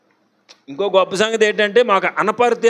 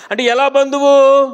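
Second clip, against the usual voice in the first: loud, high rhetorical question; 9 semitones higher, 6 dB louder than usual. Real, not acted. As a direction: throw the question at the congregation. Preacher asks the congregation.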